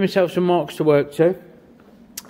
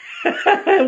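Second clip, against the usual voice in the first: high giddy laugh; happy laughter after cheering; 13 semitones higher than usual. Real, not acted. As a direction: break into a delighted laugh.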